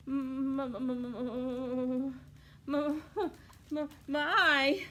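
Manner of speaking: slow, shaky stutter; stuttering fear. Acted. Terrified wolf facing grandma, stuttering. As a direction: stutter in fright. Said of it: "he finally whispered".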